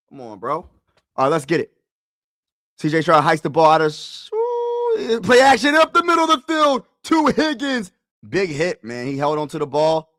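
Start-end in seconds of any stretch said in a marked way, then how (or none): 2.8-7.9 excited call leaping into high, loud cries; live play-by-play. Real, not acted. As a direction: erupt as the pass comes off.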